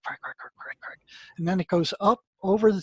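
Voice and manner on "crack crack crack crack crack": breathy, soft crack crack crack, like static; whispered crackle imitation. Telling a listener about the noise, soft whispered crackling sounds. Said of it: "It's this electrical crackling".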